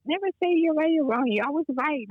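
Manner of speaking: high, firm teacher's voice; teacher's advice. Retold or imitated. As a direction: correct her like a confident teacher. Teacher quoted with big pitch jump.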